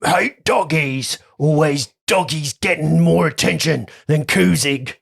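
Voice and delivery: slow, high dopey giant voice; dopey cartoon bellow. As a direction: play a dim giant whining. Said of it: "The giant says something like".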